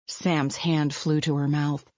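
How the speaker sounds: ordinary delivery, nothing marked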